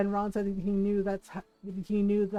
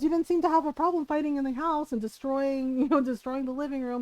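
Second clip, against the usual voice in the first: higher-voiced mock reprimand; retold scolding; 5 semitones higher, 4 dB louder than usual. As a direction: imitate a dad's pointed telling-off, pitched up. Dad retold scolding the fighting daughters.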